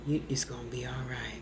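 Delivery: low, whispered reassurance; Al's whisper. Retold or imitated. Al answering her, a low soothing whisper. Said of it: "And Al be like".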